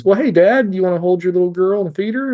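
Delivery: high, bright sing-song greeting to a new dad; cheerful nurse. Retold or imitated. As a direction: sound like a sunny nurse inviting him over.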